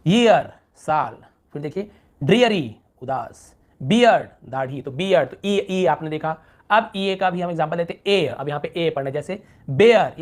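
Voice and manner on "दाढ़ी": ordinary delivery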